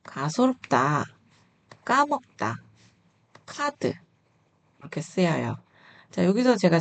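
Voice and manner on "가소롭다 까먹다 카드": 가소롭다 and 까먹다 drawn out slowly, 카드 high; word drill. Shown as a demonstration. Teacher modelling example words, careful slow 가소롭다, 까먹다, then 카드. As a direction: separate each word, showing lax 가소롭다, tense 까먹다, aspirated 카드. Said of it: "다시요".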